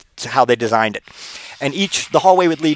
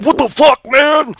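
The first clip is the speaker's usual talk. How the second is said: much higher, louder outraged yell; furious at the man who hit his girl; angry boyfriend; 12 semitones higher, 4 dB louder, slower than usual. Retold or imitated. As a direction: boyfriend yelling at the puncher, outraged. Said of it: "he's like".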